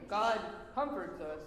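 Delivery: breaking into a laugh, then slow and low; slow chuckling line after the silly voice; laughing after the squeak. Real, not acted. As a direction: laugh it off and say it slowly. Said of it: "ready for acting school".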